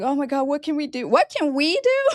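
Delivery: high, fast, rising into a squeal; mock tearful panic. Hairdresser mimicking her crying client, high and frantic. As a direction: play the client's frantic begging. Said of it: "She's in tears".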